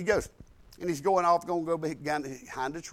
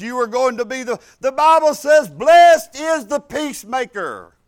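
fiery preaching; 7 dB louder than usual. Acted. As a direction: preach it hard to the congregation. Preaching at full force.

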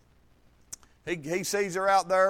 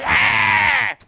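put-on toddler's angry yell; 5 semitones higher, 8 dB louder than usual. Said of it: "he's like".

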